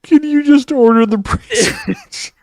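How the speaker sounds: high, slow, whiny mimicry of him; teasing high drawn-out mimic; mocking imitation. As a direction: parrot his demand in a silly high voice.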